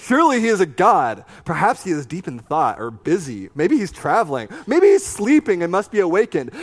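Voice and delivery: sarcastic jab at their god; taunting. A narrator's reading. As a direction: Elijah mocking the prophets, sarcastic. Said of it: "Elijah began to taunt them".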